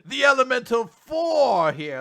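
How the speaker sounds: high, loud, drawn-out proclamation; mock grand introduction. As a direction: proclaim it slowly like a team's big entrance. Mimics grand team introduction.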